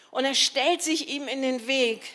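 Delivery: ordinary delivery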